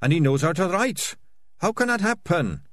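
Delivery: pitched well up, plaintive; distressed bewilderment. Acted. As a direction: ask it helplessly, voice climbing high. Dejected rat, bewildered by friends fleeing.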